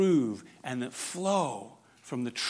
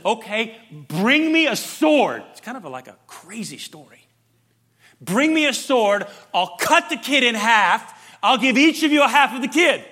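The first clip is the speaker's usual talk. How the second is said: higher, louder kingly command for a sword; King Solomon commanding; 8 semitones higher, 10 dB louder than usual. Retold or imitated. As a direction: voice the king's stern order. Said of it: "Solomon says".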